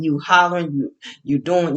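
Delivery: ordinary delivery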